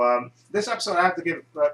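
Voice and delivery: usual speaking voice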